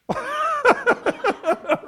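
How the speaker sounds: a sudden high, delighted laugh; high burst of laughter. Real, not acted. Preacher laughing at his own joke, high burst of laughter.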